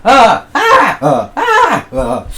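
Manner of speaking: repeated high loud shrieks; screaming explorer. Acted. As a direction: shriek again and again in panic. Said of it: "George the Englishman screamed".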